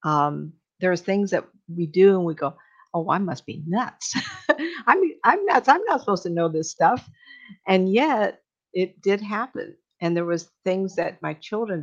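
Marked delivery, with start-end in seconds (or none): 2.9-7.1 laughing self-mock. Mocking herself, laughing.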